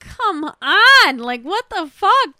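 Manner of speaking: loud, high exasperated outburst; stretched, pleading complaint at the screen; exasperated protest. Retold or imitated. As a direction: wail it in drawn-out disbelief. Retold reaction to crying scene.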